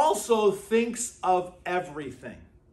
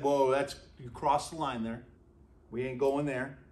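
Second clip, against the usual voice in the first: lightly voiced God, low and soft; a hint of God waving it off; casual God voice; 7 semitones lower, 7 dB quieter than usual. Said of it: "God said".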